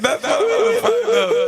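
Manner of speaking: high, loud squealing reply; excited. Real, not acted. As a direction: squeal it out eagerly.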